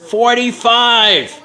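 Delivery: long drawn-out call of "Forty-five"; yelled out. Retold or imitated. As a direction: shout the number up from the truck. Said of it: "he yells at me".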